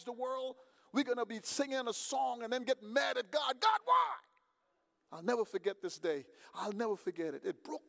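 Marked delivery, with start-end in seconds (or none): 3.6-4.2 higher, louder shouted plea; anguished cry. Acted. Churchgoer shouting at God in complaint, anguished shout. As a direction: cry out the question to the sky.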